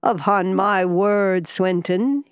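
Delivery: slow, loud exclamation; amused exclamation. A narrator's reading. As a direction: exclaim heartily, stretching the words out. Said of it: "he exclaimed".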